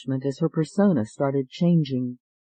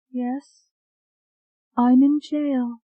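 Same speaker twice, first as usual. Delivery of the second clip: put-on distant, hollow, higher young voice; 10 semitones higher, slower than usual. Hypnotized woman answering the doctor, hollow and distant. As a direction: sound far away, as if in a trance. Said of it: "Her voice sounded hollow".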